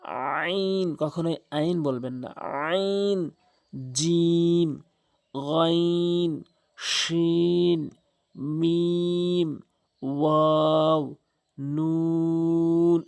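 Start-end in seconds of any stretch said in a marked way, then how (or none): 2.7-13.0 কাফ, সিন, দাল, লাম each drawn out over three alifs; stretched letter names. Shown as a demonstration. Teacher chants the three-alif letters for students to copy, very slow. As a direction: pull each letter name long and even. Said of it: "এভাবে টেনে টেনে বলবেন".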